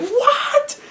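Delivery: extremely high squeak through a laugh; hammed-up disbelief breaking into a laugh; shocked Susie. Susie learning Carol is the knight, squeaky shock. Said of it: "Susie's gonna be like".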